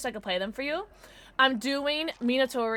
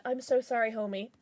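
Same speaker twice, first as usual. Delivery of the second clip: usual speaking voice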